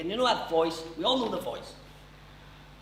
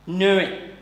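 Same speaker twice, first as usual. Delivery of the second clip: loud Newry pronunciation of Newry; Newry said the local way; Newry accent; 3 semitones higher, 7 dB louder than usual. Shown as a demonstration. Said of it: "you have Newry".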